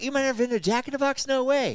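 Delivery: high, loud-ish incredulous retelling; exaggerated astonished quote; mock disbelief. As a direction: imitate an amazed group, pitch jumping way up. Retold group reacting in disbelief.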